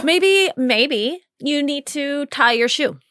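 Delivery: slow, high teasing. Real, not acted. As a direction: draw out the joke. Joking slow suggestion.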